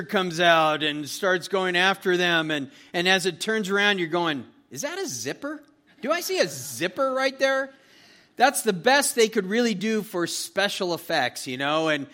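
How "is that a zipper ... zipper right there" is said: high, incredulous squint at the screen; a viewer catching the zipper, higher and amused; mock surprise. Moviegoer to friend, spotting a zipper, higher mock disbelief.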